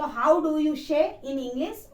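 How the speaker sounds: very slow, careful dictation pace; teacher models the formal question slowly; slow model sentence. Shown as a demonstration. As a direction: spell it out slowly for the class. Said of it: "एक sentence बना के बोलें तो".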